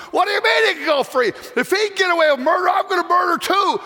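high outraged yelling crowd; angry crowd shout. Retold or imitated. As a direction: shout it like a furious mob.

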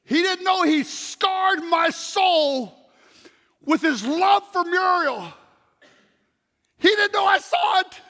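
high, slow, loud, near tears; preacher overcome, crying out to crowd; emotional outcry. Real, not acted. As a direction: cry out what moved you.

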